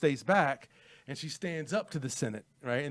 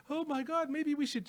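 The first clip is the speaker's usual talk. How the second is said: much higher, hammed-up panicky wife; mock-panicked high whine; mock frantic; 14 semitones higher than usual. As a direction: squeal it like a scared, frantic wife. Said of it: "She doesn't act frantic or scared at all".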